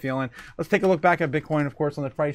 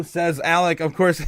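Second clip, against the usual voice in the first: usual speaking voice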